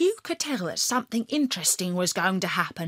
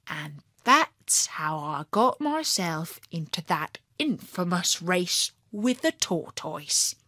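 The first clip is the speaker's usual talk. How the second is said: plain reading voice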